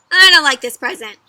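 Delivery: loud, put-out protest; displeased complaint. A narrator's reading. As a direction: reject the gift loudly. Both reject their gifts.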